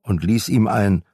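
usual speaking voice

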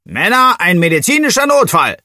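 loud, high call to the whole team; rally everyone, full volume; bellowing. A narrator's reading. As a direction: Bossi roars to the team about an emergency. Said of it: "brüllte Bossi".

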